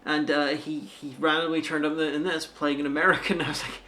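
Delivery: ordinary talk, nothing marked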